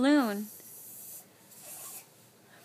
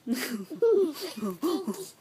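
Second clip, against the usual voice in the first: ticklish laugh; 2 semitones lower, 7 dB quieter than usual. Real, not acted. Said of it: "I'm ticklish there".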